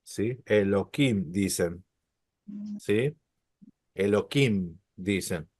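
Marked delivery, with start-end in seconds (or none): 0.5-1.3 Elohim said as "Elokim", with a hard K; H changed to K. Shown as a demonstration. Teacher showing how some people say it, Elohim with K. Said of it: "la cambian por una K". 3.9-4.8 Elohim pronounced "e-lo-KIM"; hard K replacing the breathed H in Elohim; H changed to K. Shown as a demonstration. Teacher repeating the substituted form, Elohim with K. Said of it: "la cambian por una K".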